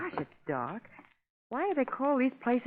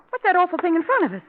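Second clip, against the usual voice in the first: frightened alarm; 8 semitones higher, 5 dB louder, faster than usual. Acted. Frightened by a shape ahead.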